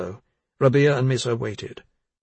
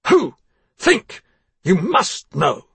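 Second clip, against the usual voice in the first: loud hammering demands, much higher; loud, rock-like; 8 semitones higher, 8 dB louder, much slower than usual. Acted. Father presses crying daughter for a name, shouting. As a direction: pound each word at her like stone. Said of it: "His voice was loud and rock-like".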